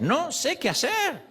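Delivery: slow, high, helpless "No sé qué hacer". Shown as a demonstration. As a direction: pray it slowly, pitched high, at a loss. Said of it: "esta es nuestra oración". (oración lenta y aguda, desamparada)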